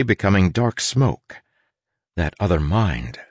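usual speaking voice

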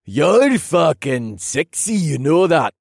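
put-on slow, loud, high-pitched drunk; sloppy drunken come-on; drunken slur. A narrator's reading. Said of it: "the lean blonde man slurred".

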